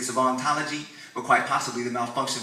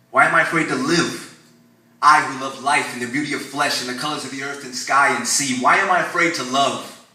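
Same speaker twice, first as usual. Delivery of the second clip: loud, higher, pleading why; spoken-word anguish, full voice; anguished loud questions; 3 semitones higher, 8 dB louder than usual. Acted. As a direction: cry the question out.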